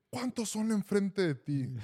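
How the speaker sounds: pregunta aguda y lenta de coach regañón; gritos del coach. Retold or imitated. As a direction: imita un reclamo gritado al oído. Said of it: "me empieza a gritar". (coach gritándole al jugador, imitado)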